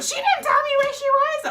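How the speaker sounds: very high, loud shriek; mock outraged yelling; retold shouted denial. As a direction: shriek it as an indignant Nana. Nana shouting her denial.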